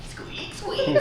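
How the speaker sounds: high mocking squeaks; mock squeaking. Sister mocks the man's shoes again, high squeaks. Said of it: "you're laughing again".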